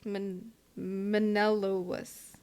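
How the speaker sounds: "Menelaus" stretched out slowly, syllable by syllable, after a false start; unsure pronunciation. Real, not acted. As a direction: sound out "Men-, Menelaus" hesitantly, testing each syllable. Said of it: "I don't know how to pr".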